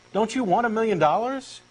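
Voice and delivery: normal talking voice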